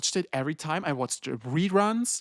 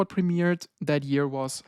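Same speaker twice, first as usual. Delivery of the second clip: ordinary delivery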